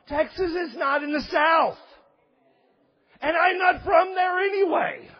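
high, loud protest; emphatic rebuttal. Real, not acted. Preacher answers objection about the South, loud and indignant.

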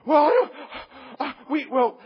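high, loud, stammering parent; hammed-up fluster, stumbling over words. Parent fumbles to bargain with kid, flustered and stammering.

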